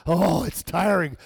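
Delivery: weary, drawn-out complaint; mock exhausted. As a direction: jokingly sound worn out, slowly. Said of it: "made that sound like it was exhausting".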